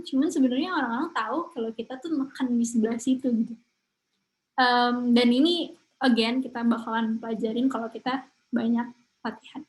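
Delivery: ordinary talk, nothing marked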